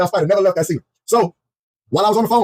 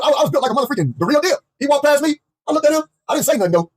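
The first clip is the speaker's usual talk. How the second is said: ordinary talk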